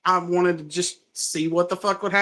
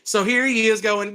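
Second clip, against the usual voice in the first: ordinary delivery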